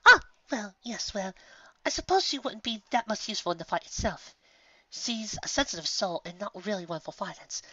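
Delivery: gentle and delicate; Rarity thinking aloud about Fluttershy; Rarity, hesitant. A narrator's reading.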